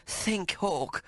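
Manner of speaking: high, urgent coaxing; urgent mother. Acted. Mary shaking her son, urging him to remember, high. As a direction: press him gently but urgently, pitched high. Mary's voice held.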